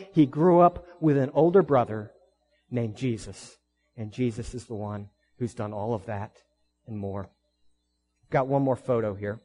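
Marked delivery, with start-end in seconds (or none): 2.7-7.3 quiet. Real, not acted. Sudden drop after climax.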